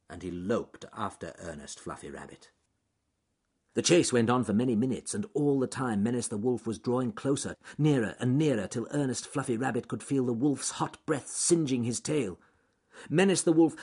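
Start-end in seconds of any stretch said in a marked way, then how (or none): none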